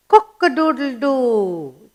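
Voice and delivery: slow, drawn-out cock-a-doodle-doo; rooster crow. Shown as a demonstration. Teacher showing children the morning call, slow imitated rooster crow. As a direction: imitate a rooster, stretching the last note downward. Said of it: "he say in the morning?".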